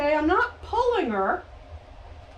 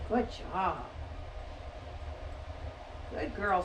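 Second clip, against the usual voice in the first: soft praise; 7 semitones lower, 10 dB quieter than usual. Real, not acted.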